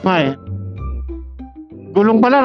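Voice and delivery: ordinary talk, nothing marked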